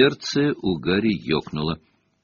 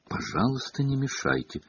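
soft, higher-pitched meek plea; meek request; 5 semitones higher, 8 dB quieter than usual. A narrator's reading. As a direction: ask gently, in a small high voice. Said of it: "кротко попросила профессор Трелани". (кротко, тихим высоким голосом)

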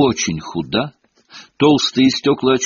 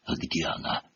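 hushed unvoiced whisper; 6 semitones lower, 13 dB quieter than usual. A narrator's reading. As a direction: whisper it into the dark. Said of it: "говоривший почему-то шёпотом".

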